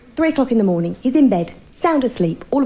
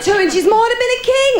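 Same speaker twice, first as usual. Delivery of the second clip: boastful husband; 9 semitones higher than usual. Retold or imitated. Husband boasting in her retelling.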